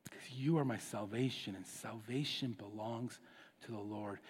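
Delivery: soft, low, near-whispered plea; hushed prayer. Acted. David crying to the Lord, hushed broken whisper. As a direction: pray it like a broken man alone. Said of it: "He's like".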